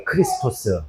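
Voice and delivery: 크리스토스 repeated low and soft, as Greek Christos; Greek pronunciation. Shown as a demonstration. Teacher repeating Greek word for class, 크리스토스 said softly. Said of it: "헬라어로 얘기하면 어떻게 얘기하냐면".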